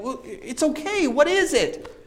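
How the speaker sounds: high, soft reassurance; acted soothing question, voice lifted and softened; gentle coaxing. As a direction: coax a confession out tenderly. Retold coaxing her to confide.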